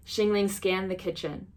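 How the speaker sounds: plain reading voice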